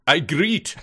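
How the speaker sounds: broken English in a mock Arabic accent; thick Arabic accent. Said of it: "I said with a thick Arabic accent".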